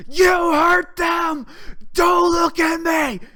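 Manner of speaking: high, drawn-out accusing scream; yell. Retold or imitated. Driver pulling up to small buses, screaming accusations. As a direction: shout each word long and very high. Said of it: "and I yell. I go".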